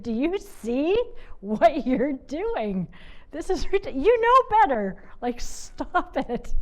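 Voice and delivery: high, loud, drawn-out self-scolding; scolding herself. Retold or imitated. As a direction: snap yourself out of it, half laughing. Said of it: "I laughed at myself. I said".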